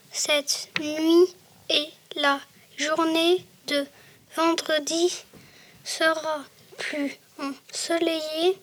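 word by word, long pauses between words; halting reading. Real, not acted. Child reading the weather forecast to the class, halting.

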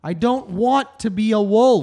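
loud, whining refusal, half joking; whiny loud protest. Real, not acted. Pleading against becoming a wolf.

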